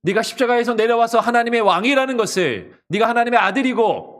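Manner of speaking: loud, high, forceful jeer; mocking challenge. Onlookers demanding Jesus prove himself, loudly mocking. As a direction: hurl it upward as a demand for proof. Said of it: "사람들이 예수님을 조롱하고".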